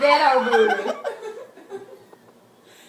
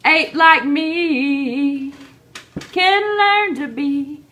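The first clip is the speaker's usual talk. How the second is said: slow dramatic; 2 semitones higher, 4 dB louder, much slower than usual. Acted. Slow dramatic quote line.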